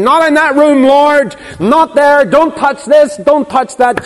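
begging the Lord to keep out; reluctant believer. Retold or imitated. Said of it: "holding on to your treasures and saying".

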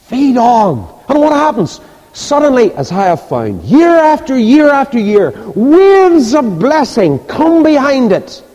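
very high, ringing pulpit cry; preaching at full force. Real, not acted. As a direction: build to a drawn-out proclamation.

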